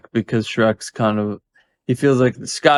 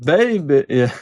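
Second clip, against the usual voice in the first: high, put-on swinging spy; playful retro catchphrase voice; raised comic spy impression; Austin Powers; 4 semitones higher than usual. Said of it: "He sounds like Austin Powers".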